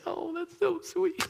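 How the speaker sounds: very high, slow imitation of a touched wife; put-on high, bashful thank-you voice; humble wife. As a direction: coo it high and drawn out. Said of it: "She's humble, and she's like".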